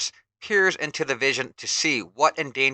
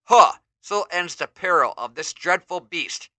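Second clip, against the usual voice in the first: triumphant hero, louder grunt then proud line; heroic proclamation; 4 semitones higher, 6 dB louder than usual. Acted. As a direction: proclaim the victory. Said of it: "He-Man proclaims".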